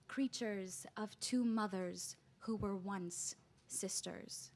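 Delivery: slow, deliberate spoken-word delivery with weighted pauses; poetry recital. Acted. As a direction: recite it as an intense dramatic poem. Reads own poem aloud.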